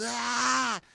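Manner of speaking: high shout as he charges; war cry yell. Retold or imitated. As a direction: yell as you tackle. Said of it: "And I'm just".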